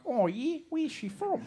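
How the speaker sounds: high, soft Australian questioning; Australian accent. Retold or imitated. Locals in Australia asking where his wife's from, Australian accent. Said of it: "Then I went to Australia".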